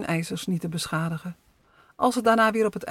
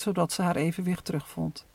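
plain reading voice

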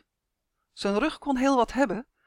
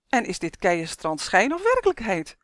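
usual speaking voice

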